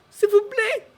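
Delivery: high, loud frightened whimper; whimpering plea. Acted. Terrified director begs Jake, high whimpering plea. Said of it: "the man whimpers".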